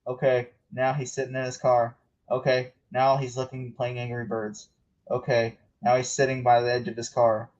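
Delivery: low deadpan play-by-play; bored commentator. Acted. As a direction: drone through trivial updates. Mocking commentary on rain-delayed race.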